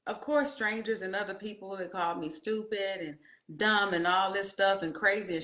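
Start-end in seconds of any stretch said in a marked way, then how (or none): none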